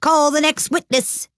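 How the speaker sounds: high-pitched royal summons; imperious call. A narrator's reading. King summoning the next witness, imperious high call. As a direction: call it out high across a courtroom.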